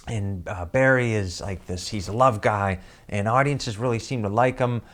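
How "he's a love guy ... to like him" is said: usual speaking voice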